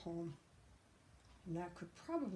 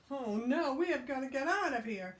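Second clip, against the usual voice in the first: panicked pigs; 6 semitones higher, 5 dB louder than usual. Acted.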